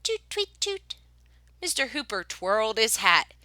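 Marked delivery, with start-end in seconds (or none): 0.0-0.9 high piping toots; whistle toots. Acted. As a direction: mimic a tin whistle up high. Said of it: "went the whistle".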